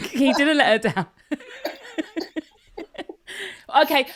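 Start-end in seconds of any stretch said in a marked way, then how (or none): 0.0-1.4 high-pitched giggles around the words; real cracking up; laughing. Real, not acted. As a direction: get it out through laughter. Cracks up while reaching climax.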